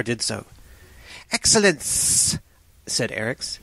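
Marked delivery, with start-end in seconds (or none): 1.3-2.4 slow, higher, long hissed s; pleased serpent man. Acted. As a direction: savour it like a snake, drawing out the hiss.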